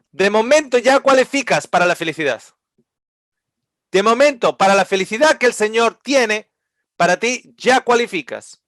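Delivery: rally the listeners with conviction; full-force preaching. Acted. (predicación ferviente en voz alta)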